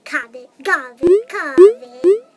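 from spoken to shouted covid; building shout. Pretend. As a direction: ramp up to a playful yell. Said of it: "May covid".

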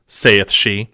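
plain reading voice

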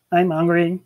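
lightly voiced listless creature; mumbling alien. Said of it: "one alien mumbled".

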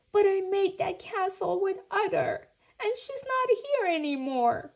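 high, loud tearful wail; acted crying protest, high-pitched; sobbing. A narrator's reading. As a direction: wail it like an upset child. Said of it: "sobbed Franklin".

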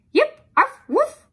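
high, loud yip, arf and woof; spoken dog barks. Acted. Dog opens her mouth to speak, only barking. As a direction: bark out three separate sharp sounds.

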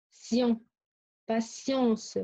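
ordinary talk, nothing marked

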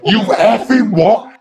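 shouted high with a laugh in it, slowed; furious outburst. Retold or imitated. Patient shouting at his psychiatrist, furious. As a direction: explode at him in disbelief.

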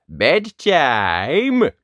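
loud, swooping drawn-out call; parental shout. A narrator's reading. Parent shouting from below, loud drawn-out call. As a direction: sing it out up the stairs. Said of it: "a shout of".

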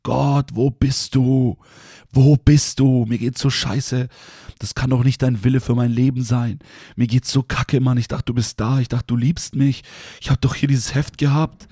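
high, loud, slow desperate plea; crying out to God. Retold or imitated. A broken young man calls out to God, loud and desperate. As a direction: cry out to God as if at the end of your rope. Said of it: "ich hab da echt zu Gott geschrien".

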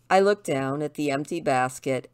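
plain reading voice

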